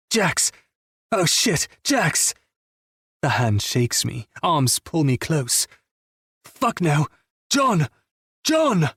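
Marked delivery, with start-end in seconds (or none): 0.1-2.3 high, panicked calling of a name; frantic shout. Acted. Killian calling out to Jax in panic, frantic shout. 6.4-8.9 high, rising frantic yelling; desperate scream. Acted. Killian screaming at John to wake up, desperate yell.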